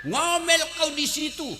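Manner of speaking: lilting, taunting, high tune; husband whistling and taunting the wife he pushed in; singsong gloat. Retold or imitated. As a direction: tease her down the well. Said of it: "di atas bersiul".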